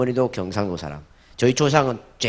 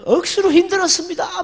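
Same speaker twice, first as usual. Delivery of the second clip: high, strained Gyeongsang whine of 억수로 힘들었습니다; Gyeongsang dialect; 5 dB louder than usual. Pretend. Solomon repenting in heaven, high Gyeongsang dialect moan. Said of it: "뭐 이런 이야기 할 것 같아요".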